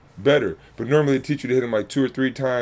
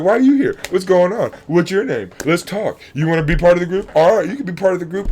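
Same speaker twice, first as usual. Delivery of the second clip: much higher, louder "Why are you here?" full of welcome; eager welcome; 6 semitones higher, 6 dB louder than usual. Retold or imitated. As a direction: pull the newcomer into the group. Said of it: "We're like".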